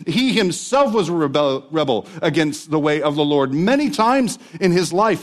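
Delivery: a preacher pressing toward the climax; preaching at full force. Real, not acted. Pastor to congregation on David's salvation, fervent preaching.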